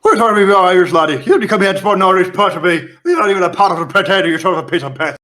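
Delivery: hammed-up Irish accent, fast; Irish imp. Imp answers the player's joke in a hammy Irish accent. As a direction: rattle it off in a mock Irish accent. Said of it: "the imps are clearly Irish".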